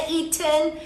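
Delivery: "eaten" with a crisp, firm T; "eaten" with a hard, fully released T. Shown as a demonstration. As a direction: say "eaten" hitting a clear T in the middle. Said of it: "con la T firme".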